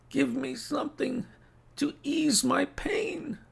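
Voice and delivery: very high, strained invalid voice; pained plea. A narrator's reading. Sick Holmes asking for relief, high pained plea. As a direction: beg thinly as if racked with pain.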